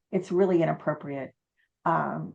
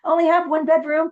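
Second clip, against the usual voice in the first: much higher and louder, flustered; starstruck squeal; 10 semitones higher, 8 dB louder than usual. Retold or imitated. Host telling [name], squealing with excitement.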